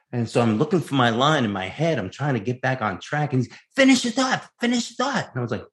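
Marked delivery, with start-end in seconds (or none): 3.7-5.3 high-pitched, insistent mimicry; Al Pacino. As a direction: press an actor to go on, imitating a star. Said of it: "I hear Al Pacino on the other line going".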